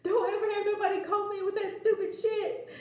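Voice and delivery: high, loud scolding through laughter; mock-angry boyfriend. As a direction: imitate an outraged boyfriend while cracking up. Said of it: "he was so mad".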